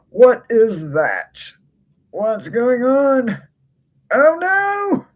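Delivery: the voice climbs into a wail of dismay; rising dismay. Real, not acted. To her viewers about her damaged deck, a climbing distressed wail. Sees the card is ruined.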